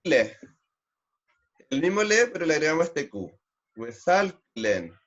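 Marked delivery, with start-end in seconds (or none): 3.8-4.9 slow demo. Shown as a demonstration. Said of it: "teacher models slow pronunciation".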